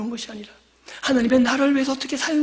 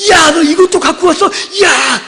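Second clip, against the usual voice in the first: sharp raised parent's yell; scolding parent; 5 semitones higher, 5 dB louder, slower than usual. Retold or imitated. Parent yelling at child caught stealing, loud scolding. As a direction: scold the child who stole.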